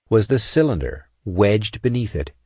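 plain reading voice

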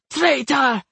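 accusing shout. A narrator's reading. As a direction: cry it out at a betrayer. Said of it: "he shouted at Tiron".

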